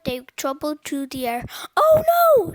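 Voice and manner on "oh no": high, loud cry of alarm; much higher and louder, dramatic dismay; panicked shout. A narrator's reading. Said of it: "shouted Cheeseball".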